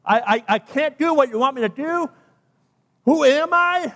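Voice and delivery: acted-out objection, rising to an incredulous question; protesting. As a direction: plead your way out, voice climbing. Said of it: "he protested".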